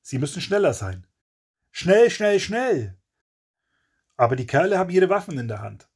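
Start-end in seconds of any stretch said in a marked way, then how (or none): 1.7-3.0 high, pressing repetition; raised-pitch, insistent urgency; urgent hurry. A narrator's reading. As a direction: urge yourself on in a panic. Narrator voices Kevin's panicked hurry.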